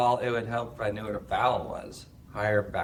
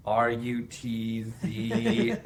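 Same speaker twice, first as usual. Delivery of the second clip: slow spelling; 2 semitones lower, much slower than usual. Shown as a demonstration. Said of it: "spelling it slowly".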